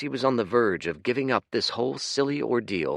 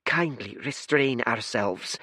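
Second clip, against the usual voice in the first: higher but gentle, calm and apologetic; 4 semitones higher than usual. Acted. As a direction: soothe the guest. Blue one calms red.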